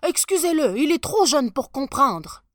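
high, loud hurried excuse; apologetic father. A narrator's reading. Father explaining the boy is too young, embarrassed. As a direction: excuse your child before a shocked crowd.

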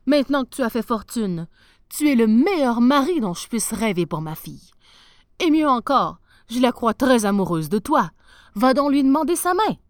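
a stranded sailor begging a king; lightly voiced captain, loud and pleading; sea captain's plea. Captain urging Henri.

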